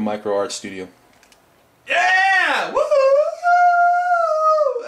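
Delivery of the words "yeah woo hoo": high, loud whooping yell with a raspy strain; excited whoop. Real, not acted. Vlogger whooping at his viewers, a high excited cheer. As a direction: let out a big excited cheer.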